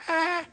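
tiny, high-pitched mock roar; little bear roar. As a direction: squeak out a small, soft cub's roar. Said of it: "he raises up on his two little paws and he goes".

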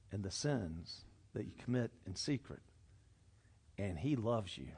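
hushed, tender reassurance; soft and tender. Real, not acted. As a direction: drop low and soft, almost confiding. Tender confession of hidden sins.

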